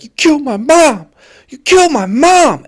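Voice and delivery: high, loud furious yell; angry yell. Retold or imitated. Inmate shouting at his mother's killer, furious high yell. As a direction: let the anger burst out. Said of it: "You could feel the anger in his voice".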